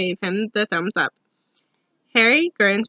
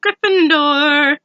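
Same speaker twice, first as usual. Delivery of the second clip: drawn-out loud higher call; hat shouting; 5 semitones higher, 6 dB louder, much slower than usual. A narrator's reading. Sorting hat naming Ron's house to the hall, loud and slow. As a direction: belt the house name slowly.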